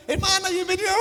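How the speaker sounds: cheery greeting call. Retold or imitated. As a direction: hail her warmly across the church. Church greeter welcoming someone.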